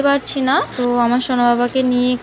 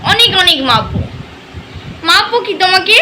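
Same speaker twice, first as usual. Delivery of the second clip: put-on high, sing-song baby talk to a toddler; 5 semitones higher than usual. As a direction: coo and tease the little one playfully. Mother baby-talks to her son.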